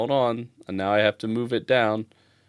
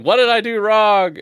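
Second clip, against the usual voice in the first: high, loud wail of bewilderment; mock-distressed cry; 9 semitones higher, 7 dB louder than usual. Streamer acting out their reaction to a mishap, mock-distressed wail. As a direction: wail it as an exaggerated protest.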